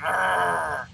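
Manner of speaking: drawn-out growl; imitated old-man growl. Player imitating old men growling at passers-by, mock growl. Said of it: "who growl at you".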